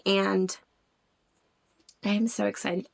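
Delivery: normal talking voice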